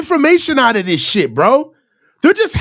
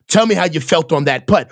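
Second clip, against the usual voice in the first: mock golf reporter; 10 semitones lower, faster than usual. Mocking golf reporter question.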